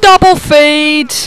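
slow loud announcer-style call; drawn-out callout. Real, not acted. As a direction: stretch it like an announcer. Calling out in game.

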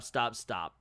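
usual speaking voice

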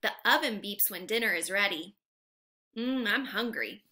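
normal talking voice

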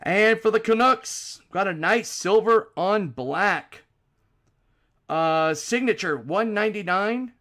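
loud, high, punchy card call; card break announcer. Real, not acted. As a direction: announce the pull with big energy. Calls out cards live.

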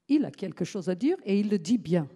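loud, quick mock pronouncement; mocking quote. Mocking the people who say it, loud and quick. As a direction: ham up the smug cliché. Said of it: "ça c'est les gens qui disent".

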